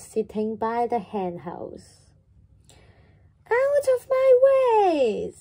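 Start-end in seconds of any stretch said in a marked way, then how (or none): 3.5-5.3 high, loud and slow, shoving past; yelling chick. Acted. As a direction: bellow it at anyone in the way. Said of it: "yelled Chicken Licken".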